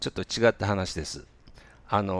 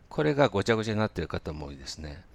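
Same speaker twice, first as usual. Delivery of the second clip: usual speaking voice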